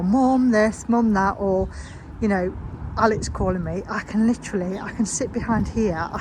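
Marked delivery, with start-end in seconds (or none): 0.0-1.4 raised, louder mock call for Mum; imitating demanding kids. Mum mimicking her kids' constant requests, mock pestering call. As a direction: mimic children pestering their mother. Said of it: "being spoken to or asked a question".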